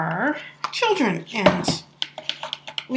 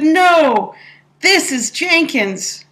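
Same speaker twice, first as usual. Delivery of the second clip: put-on childish retort, higher and louder; replying like a kid; 7 semitones higher, 5 dB louder, much slower than usual. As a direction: talk back like a stubborn child. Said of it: "I replied like a kid".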